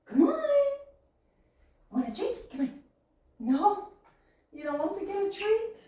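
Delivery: high coaxing pet voice; talking to a pet. Real, not acted. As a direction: sweet-talk the bird, high and soft.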